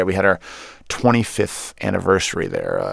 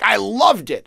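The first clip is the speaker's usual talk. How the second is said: high-pitched mock enthusiasm; gushing American; 10 semitones higher, 3 dB louder, slower than usual. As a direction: imitate an audience member raving. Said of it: "Americans, you'll be like".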